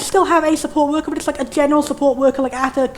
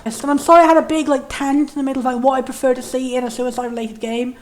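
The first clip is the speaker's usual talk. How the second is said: ordinary talk, nothing marked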